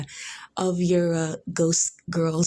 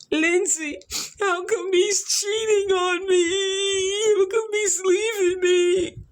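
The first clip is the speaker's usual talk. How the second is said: high, slow, sing-song whining wail; a hammed-up sobbing plea to her friend; mock wailing wife; 10 semitones higher, slower than usual. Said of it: "SpongeBob place card with the French accent".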